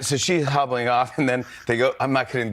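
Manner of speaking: normal talking voice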